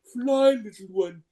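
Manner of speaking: very high, soft cartoon voice; comical cartoon character. Cartoon character freeing a little dog, comically tender. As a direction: release a tiny creature with airy, squeaky tenderness. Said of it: "more comical. Like, I catch a little dog and I go".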